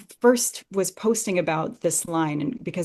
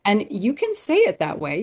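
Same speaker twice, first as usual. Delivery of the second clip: ordinary talk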